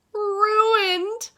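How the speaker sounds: high, loud wail; crying out. A narrator's reading. Mister Morley sees his mousse spoiled, crying out. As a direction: cry it out in dismay. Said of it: "Mister Morley cried".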